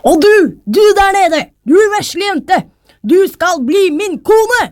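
high, projected royal call; king shouting down. A narrator's reading. King orders a girl to marry him, high calling voice. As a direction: call down imperiously to someone below. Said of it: "ropte han".

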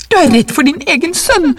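high, agitated reproach; gasping accusation. A narrator's reading. Wife accuses husband of fearing his son, agitated high cry. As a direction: throw the accusation out in a high pitch. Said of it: "sa hun og hev etter pusten".

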